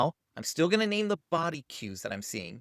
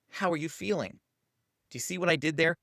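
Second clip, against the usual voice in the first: ordinary delivery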